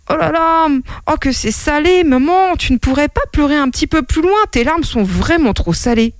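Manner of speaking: put-on high, quick little-boy grumble; complaining child. Revived boy telling mother her tears are salty, complaining. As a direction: protest like a fussy child.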